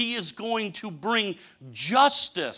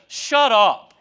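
stern rebuke; 6 dB louder than usual. Acted. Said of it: "God said".

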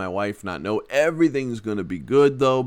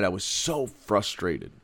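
normal talking voice

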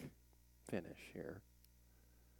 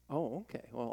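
ordinary delivery

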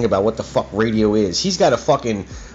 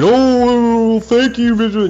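high, loud mocking imitation of grateful people; mock gushing thanks; 12 semitones higher, 5 dB louder, much slower than usual. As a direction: gush it in a put-on high voice, making fun. Mocks what grateful people said.

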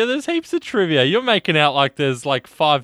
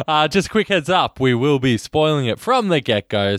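ordinary talk, nothing marked

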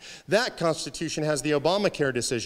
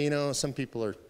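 sheepish, low and soft; halting admission, voice sinking with shame; embarrassed; 5 semitones lower, 5 dB quieter than usual. Acted. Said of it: "the embarrassment of knowing".

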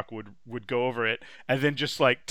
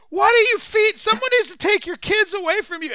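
much higher, loud outraged rant; appalled reaction guy; 9 dB louder than usual. Retold or imitated. Reaction guy scolding the cooking host, high outraged rant. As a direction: berate the host in horror. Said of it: "then he goes".

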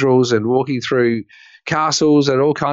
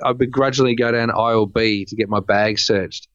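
normal talking voice

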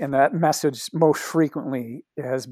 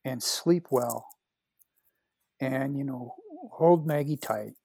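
softer, slow, gentle send-off; tender blessing; 5 dB quieter, slower than usual. Retold or imitated. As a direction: bless him quietly, a hint of the friend's tenderness. Blessing with hands on shoulders.

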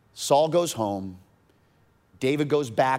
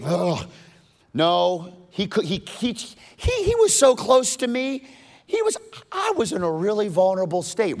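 flustered excuse; 9 semitones higher, 5 dB louder than usual. Acted. Voices a flustered Saul making excuses.

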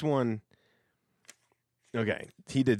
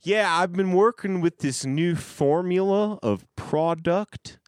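full backwoods twang; 9 semitones higher, 6 dB louder than usual. Shown as a demonstration. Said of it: "that was like product".

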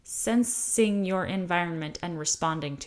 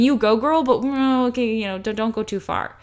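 hollow encouragement; 3 semitones higher, 6 dB louder than usual. Retold or imitated. Hollow cheer then warning.

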